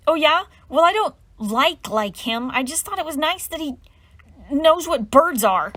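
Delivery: high, loud, upset comeback on the edge of tears; tearful retort. Acted. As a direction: fight back while about to cry. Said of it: "her eyes filled with tears".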